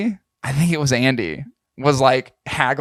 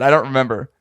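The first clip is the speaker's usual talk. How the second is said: ordinary delivery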